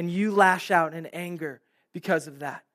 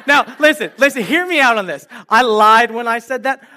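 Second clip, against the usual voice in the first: higher and louder, eager to be heard out; animated, much higher and louder; excited confession; 5 semitones higher, 10 dB louder than usual. Real, not acted. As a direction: plead with the crowd to hear you out. Confesses a lie to the crowd.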